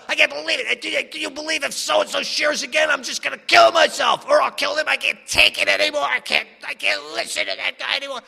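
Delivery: high, loud whiny rant; hammed-up raging gripe; mock furious complaining. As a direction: vent like a resentful drunk in the car. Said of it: "I'd be complaining about so-and-so".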